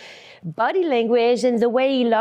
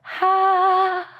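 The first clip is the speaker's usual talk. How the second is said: a high, dreamy sigh of relief; 8 semitones higher than usual. Retold or imitated. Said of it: "like that".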